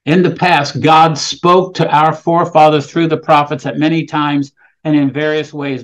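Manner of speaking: lifted, measured reading of scripture. Acted. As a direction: read the verses aloud to the congregation. Reads the scripture passage.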